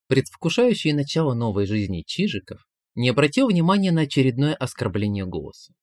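ordinary delivery, nothing marked

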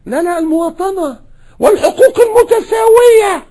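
a high, loud hammed-up shout of the slogan; mock secularist. Preacher mocking secularists: a high hammed-up shout.

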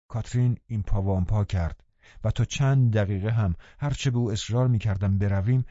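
usual speaking voice

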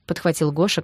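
usual speaking voice

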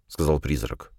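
plain reading voice

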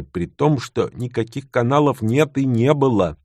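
ordinary delivery

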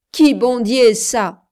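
a loud mocking sneer. A narrator's reading. Man mocking his lover's God, sneering. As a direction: scoff at her faith. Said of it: "Ricana-t-il".

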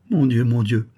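ordinary delivery, nothing marked

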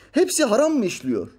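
high-pitched incredulous challenge; indignant question. Retold or imitated. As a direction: ask it as an outraged rhetorical question. Voicing Metiner's indignant question.

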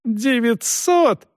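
high, drawn-out outraged yell. A narrator's reading. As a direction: cry it out in disbelief, slowly. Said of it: "заорал Пруф".

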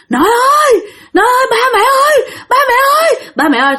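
high frightened cry for grandpa and parents; terrified calling out. Retold or imitated. Girl screaming for her family in fright. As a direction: cry out for help in terror. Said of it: "thì nó la làng lên".

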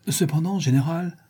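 with aplomb. A narrator's reading. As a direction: speak up to the general with poise. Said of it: "répliqua Ivan avec aplomb".

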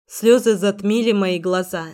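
ordinary delivery

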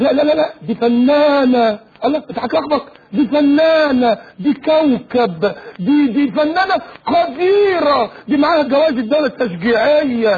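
high, slow, affected protest; hammed-up outraged fan insisting; mock defender. Mocking someone defending the actress to the sheikh.

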